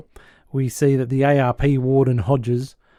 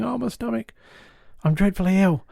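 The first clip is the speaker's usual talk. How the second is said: high, put-on sickly moan; feigning illness; 6 semitones higher than usual. German captain faking illness on the floor, high sickly voice. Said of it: "he pretends to be very, very sick".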